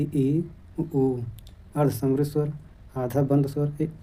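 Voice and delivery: normal talking voice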